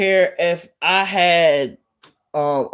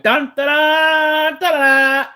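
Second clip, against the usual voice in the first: mock fanfare; 8 semitones higher, 4 dB louder, slower than usual. Mimics it growing.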